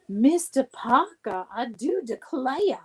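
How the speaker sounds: Southern belle, coy and slow; Southern accent. Shown as a demonstration. Wife teasing her husband by name in a Southern belle accent. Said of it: "I love to say Jeffrey Parker with a Southern accent".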